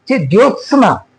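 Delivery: ordinary talk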